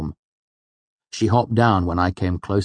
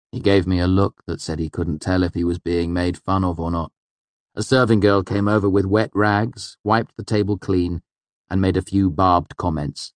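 usual speaking voice

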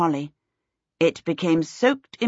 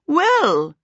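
high princess exclamation; 9 semitones higher, 4 dB louder than usual. A narrator's reading. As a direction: lift it high, as a hostess breaking in. Princess breaking in over the commotion.

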